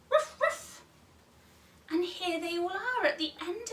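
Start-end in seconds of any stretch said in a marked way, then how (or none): 0.1-0.8 dog bark. Shown as a demonstration. Dog noise for toddlers.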